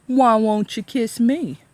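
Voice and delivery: slow, loud leering sheriff; drunk sheriff. A narrator's reading. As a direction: leer it out loud, slowly.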